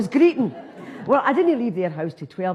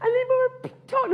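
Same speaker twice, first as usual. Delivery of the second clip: high voice. Acted. Sudden high put-on voice.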